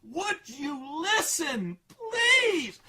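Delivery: slow, rising to a high loud shrieked please; beg, then break into a wail; exasperated plea. Retold or imitated. Daughter begging dad to listen, slow then shrieking. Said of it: "she wouldn't just scream at it".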